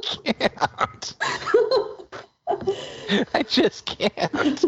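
breaking up with laughter; can't keep a straight face; laughing. Real, not acted. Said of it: "Can you say this with a straight face".

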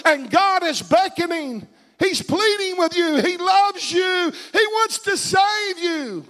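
high, urgent preaching, slowing into long stretched words; impassioned pleading. Real, not acted. Preacher pleading with the unsaved in the congregation, impassioned. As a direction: plead at full pitch, drawing out the last phrases.

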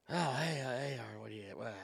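a dad dragged out of sleep, drowsy and confused; groggy half-asleep dad. Retold or imitated. Dad just woken by his son, drowsy mumble.

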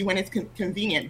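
ordinary talk, nothing marked

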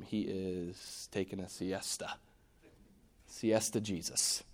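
slow, soft and low; quietly, slowly, picturing the sleeper; hushed. Acted. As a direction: lull it like someone sleeping. Sleeping Jesus in the storm.

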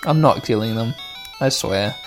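ordinary delivery

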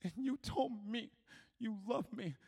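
soft, high, halting voice; re-enacted tender revelation with pauses; moved confession. Girl's emotional revelation.

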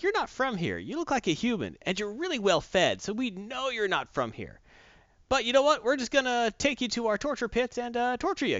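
mock villain Furies. Furies taunting captive Lois, mock villain voice.

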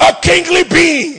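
loud, high exhortation; preaching shout. Real, not acted. Preacher calls out to the congregation, loud and high. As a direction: lift the church with your voice.

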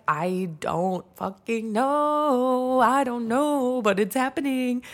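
high, drawn-out exasperated wail; exasperated retort. Retold or imitated. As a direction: stretch each word out in mock helplessness. Retelling exasperated reply to mom.

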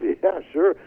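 high, laughing fake enthusiasm; a hint of a smug liar, laughing; fake eager agreement. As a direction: lie cheerfully and crack up halfway. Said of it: "I lied through my teeth".

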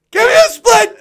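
very high, loud pleading shout, falling at the end; excited yell. Retold or imitated. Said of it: "He's like".

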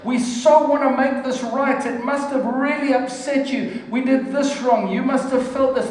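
high, earnest pleading apology; emotional apology. Retold or imitated. Husband quoting an emotional apology, high and pleading. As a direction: plead for forgiveness, voice lifted high. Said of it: "expressed their emotions and said".

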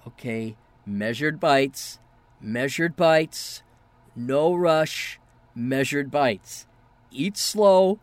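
slow, measured self-talk with pauses; deliberate self-coaching. Acted. The Flash telling himself not to eat fast, slow and deliberate. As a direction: hold yourself back, word by word. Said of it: "he's just telling himself".

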